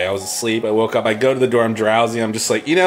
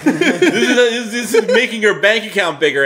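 higher, laughing through the words; laughing mockery; 6 semitones higher than usual. Ridiculing the company to friends, laughing mock voice. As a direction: laugh while sneering at them.

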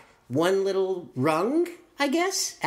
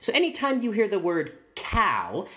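ordinary talk

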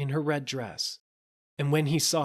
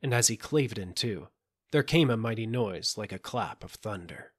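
ordinary delivery, nothing marked

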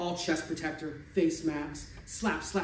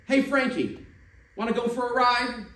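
much higher, loud call from a window; Jimmy calling Frankie from upstairs; shouting down; 8 semitones higher, 6 dB louder than usual. Acted. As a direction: call down to a friend below. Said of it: "Jimmy shouted down from the kitchen window".

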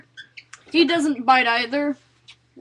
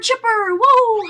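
high, loud excited whoop; excited calling out; 9 semitones higher, 8 dB louder, slower than usual. Real, not acted. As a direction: exclaim over the bird, high and loud. Greeting the pet bird excitedly.

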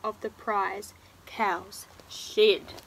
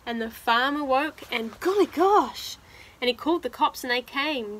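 animated, louder storybook telling; reading to children; 5 dB louder, slower than usual. Acted. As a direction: dramatise the climax for young listeners. Reads picture book aloud.

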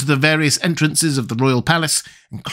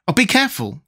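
put-on high boyish warning; alarmed boy, pitched up; crying out; 9 semitones higher than usual. A narrator's reading. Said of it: "cried the boy".

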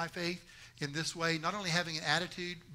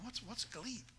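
confused question; 3 semitones higher, 11 dB quieter, slower than usual. Retold or imitated. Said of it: "scratching your head going".

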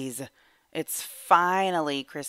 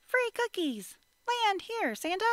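put-on much higher, sing-song child calling up to the sky; calling out to Santa. Child calling up to Santa to land on the trap, high and sing-song. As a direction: lure him in with a bright high call.

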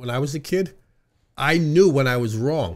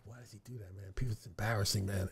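very soft imitation of complaining kids; secretive hush; 5 semitones lower, 17 dB quieter than usual. Brothers complaining privately about father, secretive. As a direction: grumble it behind his back, hushed. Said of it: "so my father couldn't hear us".

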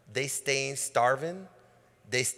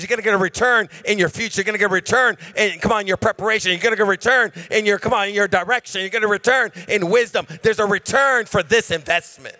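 loud, driving preacher cadence; rally the congregation to the return; preaching at full force; 6 semitones higher, 10 dB louder than usual. Acted. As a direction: preach it hard and fast.

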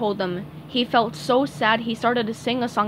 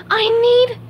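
gasped; 12 semitones higher, 8 dB louder than usual. Acted. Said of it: "gasped SpongeBob".

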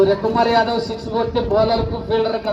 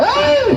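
high, loud "hey" cry; sharp rise in pitch on one cry; urging yell; 7 dB louder, much slower than usual. Real, not acted. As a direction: yell high to drive the team on. Urging on the racing team.

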